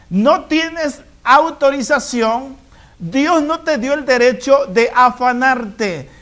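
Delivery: ordinary talk, nothing marked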